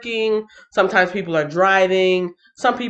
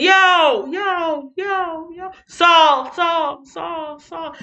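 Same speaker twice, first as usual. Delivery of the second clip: calling out; 8 semitones higher, much slower than usual. Retold or imitated. David calls out to Saul.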